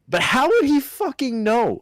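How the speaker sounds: high exasperated outburst. Real, not acted. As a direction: push the disbelief harder. Repeats the rant, louder.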